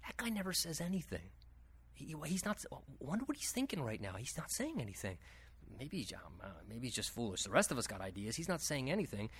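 whisper-level musing about the quiet guy; hushed onlookers. Pretend. Onlookers wondering about a silent man, hushed and low.

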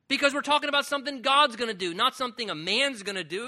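fervent preaching. Real, not acted. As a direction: press the point home with fire. Preaching at full force.

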